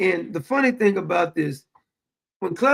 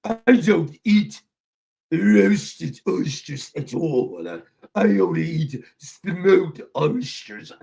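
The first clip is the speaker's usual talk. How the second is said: low, very slow mock British accent; hammed-up British indignation, every word drawn out; Clever, indignant British; 3 semitones lower, slower than usual. Imitating Clever on smoked oysters, indignant British. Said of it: "sounding British".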